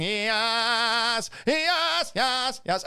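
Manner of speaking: high, drawn-out mock cheer; mock yelling. Still scrolling through comments, mock yelling. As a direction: stretch each word into a chanted holler. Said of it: "Why is this boy yelling".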